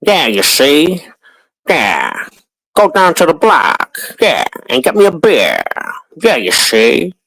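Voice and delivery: lightly done 1930s Brooklyn street voice; a hint of old-time Brooklyn tough guy; mild gangster-era New York drawl; old Brooklyn accent. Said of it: "they used to talk like".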